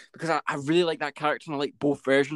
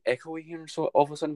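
ordinary delivery

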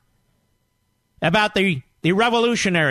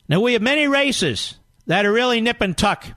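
usual speaking voice

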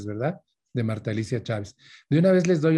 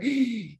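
startled gasp; 11 semitones higher than usual. Shown as a demonstration. Shows his reaction to shouting.

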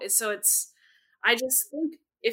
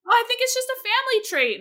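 high, fast, louder mock innocent voice; mock naive family; 7 dB louder, faster than usual. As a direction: play the family cluelessly breezy. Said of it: "they were just like".

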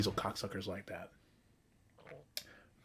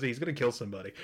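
quoting the line while laughing; laughing through quote; much faster than usual. Retold or imitated. Host quoting a favorite cartoon line, laughing through it. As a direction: try to get the words out through a laugh. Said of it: "He goes".

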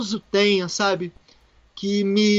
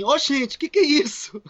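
surprised; 7 semitones higher than usual. Retold or imitated. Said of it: "E aí ela falou assim".

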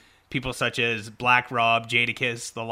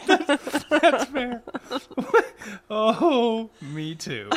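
high squealing laughter; giggling fit between words; laughing; 11 semitones higher, 3 dB louder, much slower than usual. Real, not acted. Laughing with co-host.

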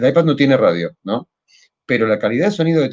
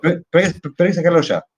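pushy boss, higher and insistent; angry boss; 5 semitones higher, faster than usual. Retold or imitated. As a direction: hurry the team along, impatient. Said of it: "un project manager que dice". (jefe impaciente y exigente)

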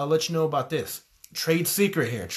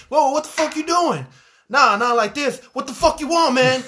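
loud, higher mock yell at a worker; rude manager yelling; 11 semitones higher, 8 dB louder than usual. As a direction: bark it out like a fed-up fast-food manager. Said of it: "And the manager was like".

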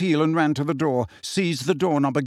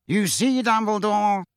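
sly, high-pitched character voice; Phineas Nigellus; 7 semitones higher than usual. A narrator's reading. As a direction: needle him slyly in a raised pitch. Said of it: "said Phineas Nigellus slyly".

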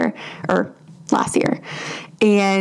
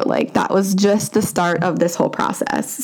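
ordinary delivery

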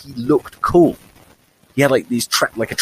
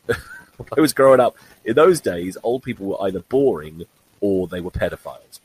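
ordinary talk

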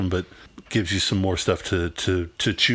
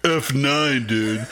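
mock insult; 4 semitones higher, much slower than usual. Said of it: "sounds more like an insult".

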